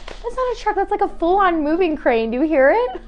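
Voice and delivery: high, loud mock-annoyed complaint about the crane; exasperated. Real, not acted. Speaking to the viewers about the noisy crane, exasperated. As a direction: pitch up and grumble at the noise.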